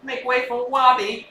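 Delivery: "Wobby" with a w sound, announced grandly; "Make way for Wobby", R as w; says w for r. Shown as a demonstration. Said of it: "I just can't say my R's".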